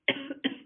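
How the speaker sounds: short cough; small cough mid-phrase. Real, not acted. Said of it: "Sorry for coughing".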